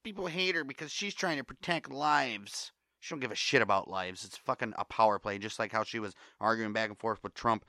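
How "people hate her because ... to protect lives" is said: higher, slower mocking voice; mocking Twitter people. Ranting vlogger mocks Twitter defenders in a higher, slower voice. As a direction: parody the preachy online crowd. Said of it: "all the people all over Twitter".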